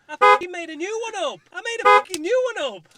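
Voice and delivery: loud, high gleeful yell; excited shout. Real, not acted. Presenter crowing about his made-up ad, loud and high. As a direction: shout it out in triumph at your own joke.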